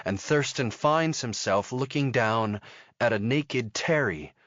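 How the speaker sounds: plain reading voice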